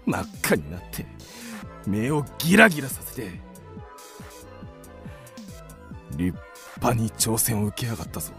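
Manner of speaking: low, soft awed musing; hushed amazement. Acted. Man marvelling at the woman who took his challenge, hushed. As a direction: drop to an amazed mutter to yourself.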